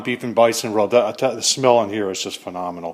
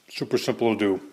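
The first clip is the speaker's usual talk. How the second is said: talking with mouth full; words round a bite of food; 2 semitones lower, 6 dB quieter than usual. Real, not acted. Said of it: "talking to you with my mouth full".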